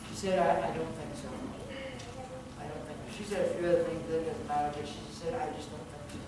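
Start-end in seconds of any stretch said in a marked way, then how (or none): 0.4-3.2 subdued refusal. Retold or imitated. Woman declining church invitation, retold. 5.3-6.2 very soft, low reluctant reply; subdued refusal. Retold or imitated. Woman declining church invitation, retold.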